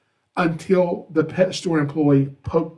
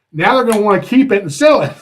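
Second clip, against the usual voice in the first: louder and higher, rushing the panicked thought; alarmed realization; 5 semitones higher, 8 dB louder, faster than usual. Retold or imitated. Realizing the pet store will keep the snake, alarmed rush. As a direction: blurt it out fast as the dread hits.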